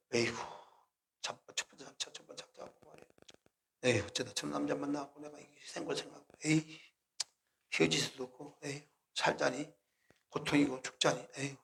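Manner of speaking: soft, sighing lament over her lot; hushed, weary grumbling with sighs. Retold or imitated. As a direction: sigh it out like a tired wife. Wife sighing over her marriage.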